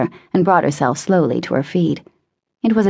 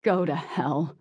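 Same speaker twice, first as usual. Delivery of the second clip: moaned curse; 10 dB quieter, slower than usual. A narrator's reading. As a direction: moan it out with venom. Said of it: "he moaned".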